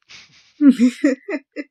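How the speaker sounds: high burst of laughter; loud laugh. Real, not acted. Reader laughing at his own blooper, loud laughter. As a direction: crack up loudly.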